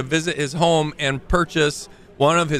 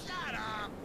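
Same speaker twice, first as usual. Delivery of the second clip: distant yell; 8 semitones higher, 17 dB quieter than usual. Acted. Yelling NPC on street.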